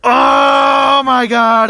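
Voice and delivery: loud, slow drawn-out groan of despair; a real exasperated outburst; exasperated shout. Real, not acted. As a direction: cry out at the awful puns. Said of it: "I wanna kill myself".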